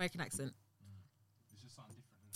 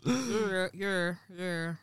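Baltimore accent; much slower than usual. Shown as a demonstration. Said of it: "Do you wanna hear the Baltimore accent".

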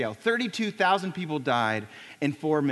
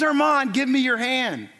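higher, louder urgent reach; urgent call; 5 semitones higher, 4 dB louder than usual. Retold or imitated. Father calling down into rubble to his son, urgent and raised. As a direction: call down to him, hand out.

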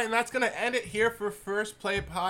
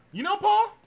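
high mock voice; 13 semitones higher, 5 dB louder than usual. Repeating song line in voice.

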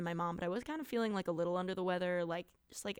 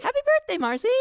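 loud, very high cheer; squealed, celebratory shout; excited birthday shout; 11 dB louder than usual. Real, not acted. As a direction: call out the birthday wish gleefully. Calls out birthday wish to friend.

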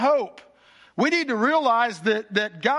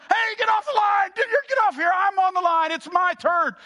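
mock yelling; 10 semitones higher than usual. Neighbors yelling at the brother jamming the line, high mock shout. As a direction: yell them off the line in a high voice. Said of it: "And they're yelling".

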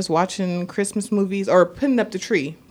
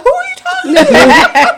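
put-on much higher, slow little-kid voice; imitating little boy; 8 dB louder, slower than usual. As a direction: squeak it like a curious five-year-old. Imitating small son.

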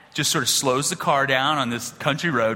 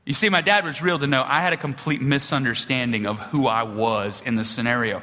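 ordinary delivery

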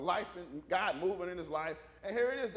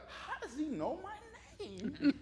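put-on puzzled kid; 6 semitones higher, 3 dB quieter, slower than usual. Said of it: "he's looking at me like".

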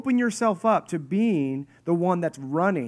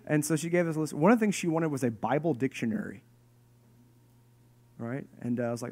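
ordinary talk, nothing marked

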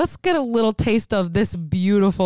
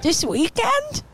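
high, slow, drawn-out mocking whine; mock whiny boyfriend; 11 semitones higher, slower than usual. Friend mocking the boyfriend's line, high drawn-out whine. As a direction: stretch it out high like a sulky boyfriend. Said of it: "Listen to his voice".

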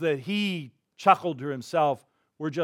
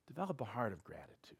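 hushed whisper; 5 semitones lower, 17 dB quieter, faster than usual. Real, not acted. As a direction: breathe it like a quiet secret to the congregation. Preacher's closing exhortation.

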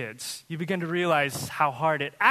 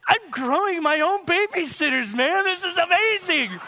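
higher and louder, giddy delight; excited; 11 semitones higher, 5 dB louder than usual. Retold or imitated. Parent of five bragging about babysitters, excited. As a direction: gush like you just won. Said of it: "getting excited".

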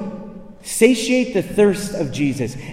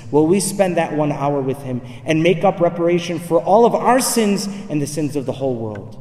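ordinary talk, nothing marked

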